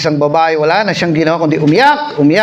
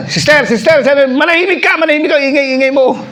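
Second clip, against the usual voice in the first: high, raised hushing voice; hint of a scolding counselor; 8 semitones higher than usual. Preacher voices the counselor shushing the noisy girl, a hint of scolding.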